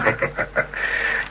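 a soft, self-satisfied snicker under the breath; quiet gloating laugh; smug chuckle. Real, not acted. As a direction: chuckle smugly at her trouble. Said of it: "zelf genoegzaam om te grinniken".

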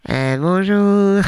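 slow, put-on doorman greeting; a bouncer peering through the hatch; gruff doorman voice. Doorman greeting through the small door, put-on voice.

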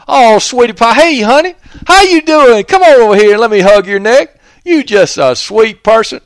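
high, loud, syrupy Southern granny voice; sweet Southern lady. Retold or imitated. Imitating a doting Southern granny greeting a visitor. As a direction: gush over the guest like a doting aunt.

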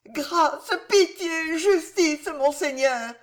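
high, desperate begging; crying out. A narrator's reading. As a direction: beg as if on your knees. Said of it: "cria Jeanne en tombant à genoux".

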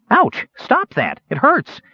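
sharp high cries of pain; pained yelp. A narrator's reading. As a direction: yelp as if your finger's being yanked. Ring pulled from his finger.

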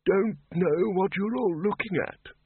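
put-on high, slow, thin old wizard's voice; a doddering old man, cheerful and oblivious; creaky old wizard chirping cheerfully; cheerful old Windle. A narrator's reading. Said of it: "said Windle cheerfully".

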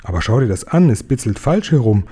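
ordinary delivery, nothing marked

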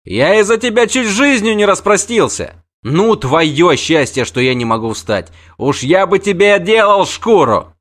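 high, loud threatening rant; the wounded knight threatens to tan the girl's hide; furious scolding. Acted. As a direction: bluster and threaten from the sickbed. Freixenet scolding.

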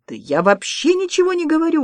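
high-pitched protest; indignant. A narrator's reading. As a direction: protest innocence in a high, offended voice. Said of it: "возмутился Добраницкий".

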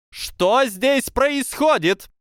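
very high, loud, very slow shriek; piercing squeal. A narrator's reading. Professor Flitwick bursting in on the girls, piercing furious squeal. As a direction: screech it like a furious tiny teacher. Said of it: "раздался пронзительный визг".

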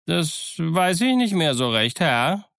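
high-pitched, hesitant sailor's answer; evasive sailor. A narrator's reading. Sailor evasively answering his questioner.

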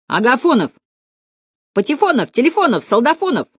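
high heckles, each name rising and falling; mocking corrections. A narrator's reading. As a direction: chime in like teasing pupils. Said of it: "поправляли Слушкина".

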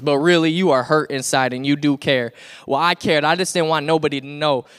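ordinary talk, nothing marked

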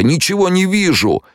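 high-pitched panicked cry; wailing cry. A narrator's reading. Blinded soldier crying to anyone near, wailing. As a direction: wail it high, newly blinded. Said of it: "завопил кто-то".

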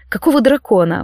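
usual speaking voice

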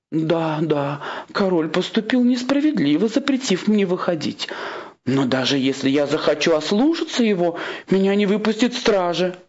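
high-pitched complaining queen; aggrieved queen. Acted. Queen voiced in a story.

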